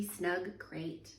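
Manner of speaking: ordinary delivery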